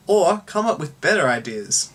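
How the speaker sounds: normal talking voice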